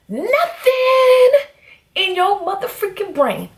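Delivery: high, exasperated outburst; indignant rant peak. Retold or imitated. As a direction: scold him, voice shooting up. Scolding boyfriend in retold rant.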